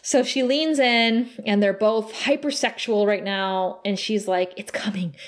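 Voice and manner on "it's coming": soft, breath-only acted whisper; hushed seductive whisper. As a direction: whisper it as if leaning in close. Voicing hypersexual character leaning in.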